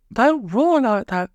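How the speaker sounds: put-on small girl pleading, high and slow; slow, high childlike plea; high little girl voice. A narrator's reading. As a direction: voice Polly as a timid little girl. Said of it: "said Polly".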